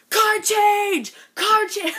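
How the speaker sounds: high, loud excited shout; retold excited yell. Cam shouting at the camera guy during the photo. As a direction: bellow it like a hyped boy.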